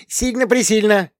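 put-on high childish insistence; a tiny child confirming eagerly; little girl insisting. A narrator's reading. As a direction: younger daughter backing up her sister. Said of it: "подтверждала младшенькая".

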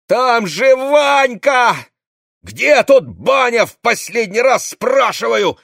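high, loud, slow panicked demand; frantic shout. Acted. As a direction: shake the answer out of him. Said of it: "трясла она".

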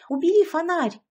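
high, loud indignant bark; barked command. A narrator's reading. Yashka climbing out snaps at the torch holder, barking shrilly. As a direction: yelp the order sharply. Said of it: "рявкнула она".

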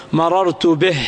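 به stopped as "bih", no vowel after h; silent-vowel ha. Shown as a demonstration. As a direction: end مررت به on a bare h. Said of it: "ووقف على الهاء ساكنة". (الوقف على به بهاء ساكنة)

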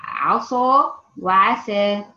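عصا with heavy emphatic ṣ, عسى with light s; slow and loud; contrasting s and ṣ. Shown as a demonstration. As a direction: spell out عصا وعسى slowly. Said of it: "زي مثلًا كلمة".

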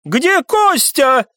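high, drawn-out frantic cry; stretched high-pitched outburst; hysterical. A narrator's reading. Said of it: "вдруг истеричным тоном воскликнул Виконт".

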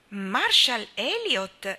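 nome ripetuto con stupore, allungato; esclamazione stupita. A narrator's reading. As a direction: exclaim it slowly and loudly, in disbelief. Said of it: "esclamò Anna". (esclama incredula, allungando il nome)

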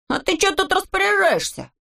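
high-pitched indignant challenge; high, affronted character voice; indignant interruption. A narrator's reading. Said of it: "вмешался Незнайка".

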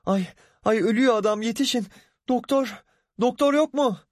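high, fast panicked wail for help; wailing cry. A narrator's reading. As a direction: shriek for a doctor, desperate. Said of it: "diye feryat etti". (feryat ederek)

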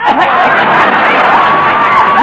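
high loud squawk of protest; outraged shriek. Acted. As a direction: shriek at being tricked. Tricked into swallowing medicine.

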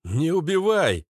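loud, high, drawn-out plea; urgent shout. A narrator's reading. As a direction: shout high to stop someone. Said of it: "крикнул Резвый". (громкий умоляющий крик)

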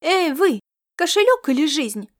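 высокий мальчишеский выкрик; выкрикни повыше, как юный разбойник; срывающийся фальцет. A narrator's reading. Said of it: "выкрикнул срывающимся фальцетом". (высоким срывающимся голосом)

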